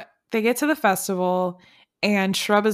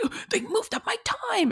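very high, thin imitated fretting; mock dismay. Podcaster mimics Kelly over the moved contest time, mock dismay. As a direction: act out a tiny crisis way up high. Kelly's big-deal reaction acted out.

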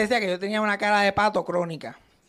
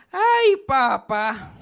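slow, drawn-out, louder "Ay, papá"; girlfriend's "ay, papá"; 4 semitones higher, 6 dB louder, much slower than usual. Retold or imitated. Imitating the girlfriend after she laughs, slow and drawn out. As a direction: stretch out "Ay, papá" in a breathy mock sigh. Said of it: "ella terminaba de reírse y decía".

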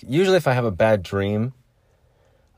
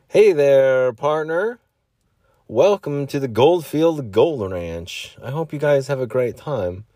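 hammed-up cowboy welcome, pitched up and drawn out; cowboy greeter; 4 semitones higher, slower than usual. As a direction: greet guests like a show cowboy. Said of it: "dress up as cowboy".

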